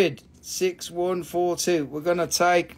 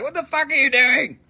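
higher-pitched mock outburst from a husband; nagging husband; 7 semitones higher, 3 dB louder than usual. Seller mimics a buyer's husband objecting to purchases, mock outrage. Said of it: "Your husband would be like".

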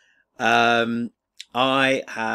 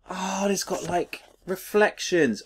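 excited; 9 semitones higher than usual. Real, not acted. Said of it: "genuinely excited".

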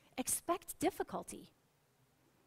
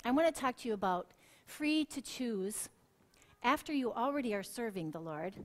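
ordinary talk, nothing marked

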